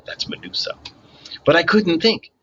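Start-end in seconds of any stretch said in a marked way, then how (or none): 0.0-0.8 breathless whispered aside; quiet unvoiced aside. Real, not acted. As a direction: hush it to yourself.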